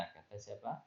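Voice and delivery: ordinary talk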